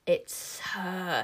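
drawn-out witchy hiss; hissing witches. A narrator's reading. The witches spot the girl with magic, hissing. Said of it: "hissed the witches".

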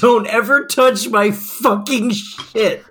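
slow, drawn-out repeat of a threat; mock quoted threat. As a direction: stretch each word, savouring the quote. Co-host quotes the wrestler's threat back.

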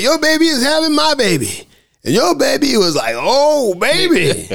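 giddy high-pitched mimicry; high on laughing gas. Butters raving on nitrous oxide in dentist's office, giddy. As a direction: babble in a high, gassed-up voice. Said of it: "all getting high on".

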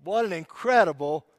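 slow, hammed-up praise to the wife for the salt; mock praise to wife. Husband thanking his wife for corn, mock silly praise. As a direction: gush a little too much over the salt. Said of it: "Do I say, "Honey".

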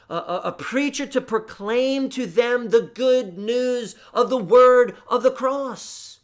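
high, loud proclamation; preaching at full force. Acted. Preacher rousing his congregation, loud and high. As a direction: drive it home to the pews.

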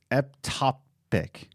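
"ectopic" sounded out slowly, "ep-TOP-ick"; halting, drawn-out "ectopic" said as "eptopic"; careful pronunciation attempt. Shown as a demonstration. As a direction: try "ectopic" syllable by syllable, with a P for the C. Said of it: "okay, let me try".

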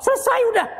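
high, bright cry that it's done; delighted exclaim. Real, not acted. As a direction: announce it's over with a lift.

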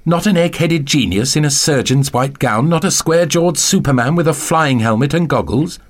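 plain reading voice

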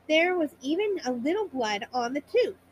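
plain reading voice

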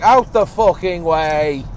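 loud, slow mock shout at other drivers; road rage yell. Driver in a big pickup yelling at people, loud mock shout. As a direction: bellow it like you own the road. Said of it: "having one of them is just a bit like".

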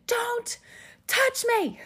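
very high, tense command; high-pitched mock order, words split apart; retold labor outburst. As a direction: mimic yourself warning him off mid-labor. Retold snapping at husband in labor.

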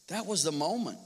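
usual speaking voice

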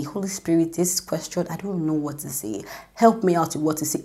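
soft, hushed plea to the Holy Spirit; quiet prayer. Retold or imitated. Speaker voices her prayer to the Holy Spirit, soft and hushed. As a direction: pray it under your breath.

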